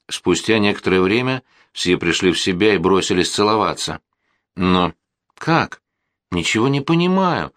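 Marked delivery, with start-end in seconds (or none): none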